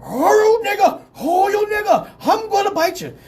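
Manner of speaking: high, loud barked words; hammed-up attack dog voice; barking dog. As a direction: talk in barks as an aggressive dog. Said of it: "the dog the whole time was".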